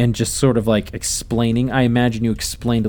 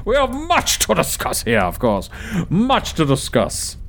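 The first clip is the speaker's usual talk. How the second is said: boisterous host; 7 semitones higher than usual. Acted. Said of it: "and he goes".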